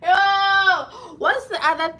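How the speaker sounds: high, loud startled yo; loud exclamation. Real, not acted. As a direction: exclaim it suddenly.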